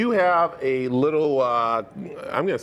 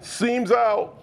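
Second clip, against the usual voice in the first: quoting Ace Ventura; 8 semitones higher than usual. Retold or imitated. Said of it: "What's that from".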